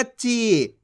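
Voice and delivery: ची with a long, held ee; teacher's drawn-out ची, falling at the end; stretched long ee. Shown as a demonstration. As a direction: draw out the vowel in ची. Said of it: "खींच के पढ़ा जाता है".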